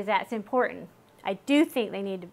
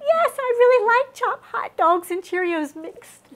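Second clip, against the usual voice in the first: put-on much higher, laughing kid's voice; high, giggly kid voice; mock kid voice; 12 semitones higher, 6 dB louder than usual. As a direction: play the giddy child proposing a weird dinner. Voicing a kid's odd food idea.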